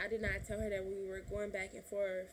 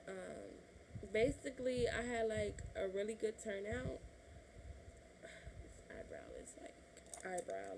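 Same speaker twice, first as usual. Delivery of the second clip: ordinary delivery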